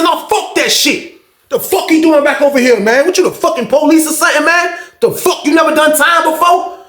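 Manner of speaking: high-pitched indignant tirade, imitated; retold angry rant. Old head's angry rant, retold.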